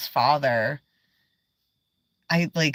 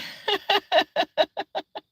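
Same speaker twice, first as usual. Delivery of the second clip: high, rapid staccato giggle; real high-pitched giggle; giggling fit. Real, not acted. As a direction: burst into a high laugh. Cracks up at own remark.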